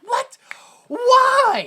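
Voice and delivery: high mock-panicked yelps; hammed-up alarmed shout; freaking out. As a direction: cry out in disbelief, shooting way up high. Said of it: "and he freaks out because".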